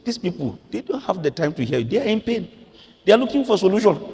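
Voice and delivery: high, urgent voice of God. Retold or imitated. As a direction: plead it high for the hurting. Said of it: "God will say".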